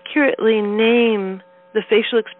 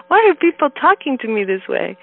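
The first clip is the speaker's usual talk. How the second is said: higher, amused bafflement; lightly incredulous question, pitched up; mock bewilderment; 5 semitones higher, 3 dB louder than usual. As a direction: replay being puzzled by people. Retells her bewilderment at the weekend.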